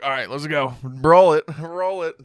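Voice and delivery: put-on loud drunk-sounding roll it; mock shouted order. Imitating actor ordering crew to roll, loud mock shout. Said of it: "He's like".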